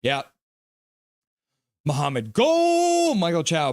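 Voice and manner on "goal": high, loud, stretched goal shout; long celebratory cry; goal cheer. Acted. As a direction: holler it like a celebrating fan.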